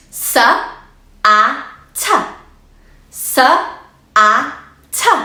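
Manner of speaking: "S", "a", "t" as separate sounds; sounding out. Shown as a demonstration. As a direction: segment the word slowly. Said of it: "Let's blend it together".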